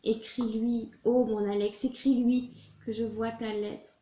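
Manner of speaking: impassioned, pleading love-letter recital; earnest theatrical reading with swelling emphasis; dramatic letter reading. Acted. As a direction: read it as a heartfelt plea to a lover. Reads a love letter aloud.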